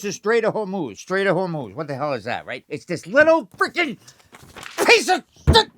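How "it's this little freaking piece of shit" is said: much higher, louder outburst; furious rant. Acted. Comic ranting over the Strait of Hormuz, furious yell. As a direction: blow up at the strait.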